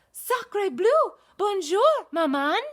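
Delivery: put-on high, loud French baby duck. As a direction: chirp hello like a tiny French duckling.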